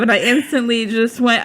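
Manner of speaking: ordinary delivery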